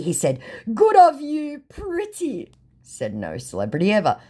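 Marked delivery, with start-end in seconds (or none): 0.7-2.5 much higher, put-on simpering voice; hammed-up flattering scammer; mock scammer voice. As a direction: coo it like a fake star. Voicing scammer's reply.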